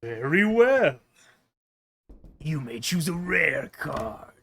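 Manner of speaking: a touch of a stern courtroom judge. Retold or imitated. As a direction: deliver the ruling like a judge. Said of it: "I want to say them in the judge's voice".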